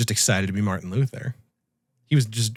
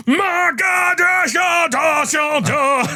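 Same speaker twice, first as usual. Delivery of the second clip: maniac shouting; much slower than usual. Retold or imitated. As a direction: belt it out like a crazed monk. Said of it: "shouting it at the top of his lungs like a maniac".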